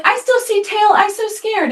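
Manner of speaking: put-on high little-girl voice, whimpering complaint; be the two-year-old who still sees the tail; tiny frightened child fretting; scared toddler.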